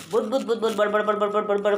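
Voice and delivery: rapid mumbled bud bud bud, imitating murmuring; murmuring noise demo. Shown as a demonstration. Teacher showing students what murmuring sounds like, rapid babble. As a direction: babble the syllables fast to show a murmur. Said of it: "Murmuring मतलब होता है slow sound".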